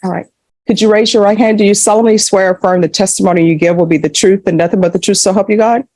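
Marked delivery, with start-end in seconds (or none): 1.6-5.9 fast, rattled-off legal formula; rote court oath. Real, not acted. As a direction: recite it quickly like routine. Judge administers the oath.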